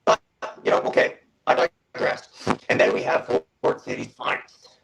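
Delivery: robotic, glitchy connection voice; robot voice over a breaking line. Real, not acted. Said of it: "you sound like a robot right now".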